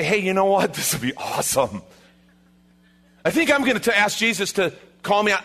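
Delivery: playful, impulsive disciple; gung-ho Peter. Acted. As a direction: pitch a wild idea as if it's awesome. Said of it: "what made him say".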